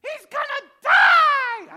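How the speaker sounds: shrill-free high, loud, slow mock wail; hammed-up panicked mother screaming; crying mom. Mom begging dad to stop, hammed-up high screaming. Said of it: "she's just crying".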